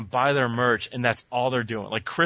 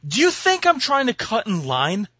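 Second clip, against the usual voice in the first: imagined rant; 8 semitones higher than usual. Retold or imitated. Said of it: "and go".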